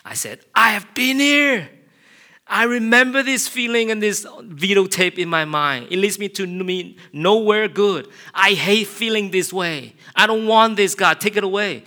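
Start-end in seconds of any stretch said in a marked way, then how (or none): 0.5-1.7 a full, high shout at the top of his voice. Retold or imitated. Pastor alone in his car shouting at his own anger. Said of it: "So I began to shout". 2.5-11.8 high, loud pleading cry; desperate shout. Retold or imitated. Pastor crying out to God in his car, loud pleading shout. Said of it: "So I began to shout".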